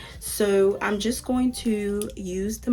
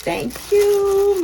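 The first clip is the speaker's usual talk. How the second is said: excited thanks; 11 semitones higher, 7 dB louder than usual. Real, not acted. Thrilled by the gift wig.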